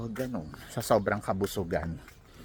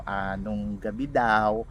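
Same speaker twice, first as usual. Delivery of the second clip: ordinary delivery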